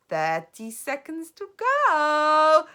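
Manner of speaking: high, loud, slow witch countdown; gloating cry. Acted. Grand High Witch counting down, slow and loud. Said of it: "cried the Grand High Witch".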